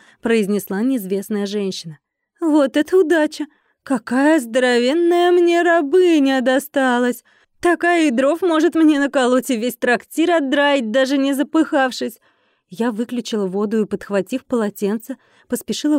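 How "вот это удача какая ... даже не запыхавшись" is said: higher, louder gloating woman's voice; brash gloating newcomer. A narrator's reading. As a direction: crow over an unexpected prize.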